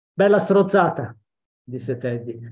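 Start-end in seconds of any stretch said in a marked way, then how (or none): 0.1-1.2 louder, higher jeering yell; scornful shout. Acted. Teddy mocking the letter, shouting it. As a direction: shout the taunt right back at them. Child repeats the taunt louder.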